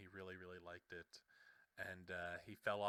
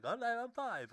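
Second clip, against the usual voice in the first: put-on high, loud British-accented kid voice; mock British child; 13 semitones higher, 7 dB louder, slower than usual. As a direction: imitate a five-year-old with a British accent. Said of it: "I don't know why he's British there".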